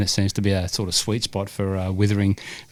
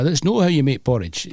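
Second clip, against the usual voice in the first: high-pitched mock Scottish ad voice; put-on Scottish accent from a cheesy ad; fake Scottish accent; 6 semitones higher than usual. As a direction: imitate an Aussie faking a Scottish accent. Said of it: "they were Aussies doing the accent".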